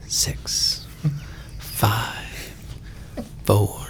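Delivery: whispered countdown; whispering. Pretend. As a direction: breathe the numbers out.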